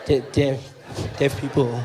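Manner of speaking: low, broken into long pauses; halting disbelief. Retold or imitated. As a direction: stall between words, stunned and careful. Incredulous, halting repeat of the question.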